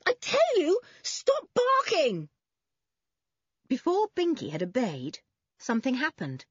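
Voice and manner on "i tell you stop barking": high, slow, emphatic shout. A narrator's reading. Boy ordering his dog to stop barking, shouting. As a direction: spell out the order at a shrieking pitch. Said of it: "shouted Toby".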